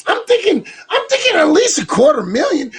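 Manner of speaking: high, loud rising hype; excited. Real, not acted. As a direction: pump up the payout excitedly. Excited money pitch.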